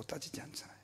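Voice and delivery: 따지지 않잖아요 dropped to a soft whisper; whispered repeat. Shown as a demonstration.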